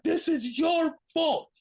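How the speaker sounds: high, slow, drawn-out imitated blame; mock anguished accusation. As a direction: wail the blame melodramatically. Retelling wrestler's anguished accusation.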